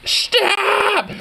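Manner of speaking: high, shouted mocking stop; mocking shout of a bystander; mock yell. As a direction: mimic a bystander screaming stop. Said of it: "People always yell stop".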